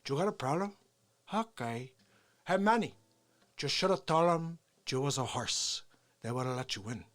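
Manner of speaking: thick-accented gangster impression; Scarface. Comic as Pacino's Scarface talking to Manny, tough impression. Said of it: "he was Scarface".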